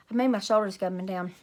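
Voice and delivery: plain reading voice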